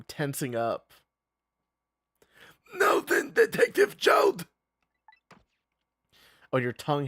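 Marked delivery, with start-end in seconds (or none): 2.7-4.5 put-on very high strained old man; pompous high old man. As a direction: address the detective pompously up high. Old man character addresses detective.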